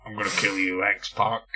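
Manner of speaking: low, quiet growl of a wrestler; Kane impression. Imitating wrestler Kane threatening X-Pac, low and quiet. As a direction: menace it out under the breath. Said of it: "talking like a mid-nineties Kane".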